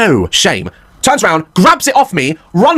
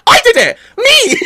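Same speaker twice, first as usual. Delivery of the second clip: much higher, loud laughing yell; claiming credit shout; 4 dB louder, much slower than usual. Retold or imitated. Dad shouting to the teacher that he made it, laughing. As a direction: shout it like a proud child. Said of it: "I was like".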